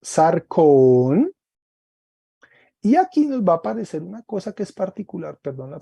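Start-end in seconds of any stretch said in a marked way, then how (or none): 0.0-1.3 "sarcón" with a loud, long final syllable; "sarcón", last syllable held and rising; circumflex accent. Shown as a demonstration. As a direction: stretch and lean on the "cón" of "sarcón". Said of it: "acento circunflejo".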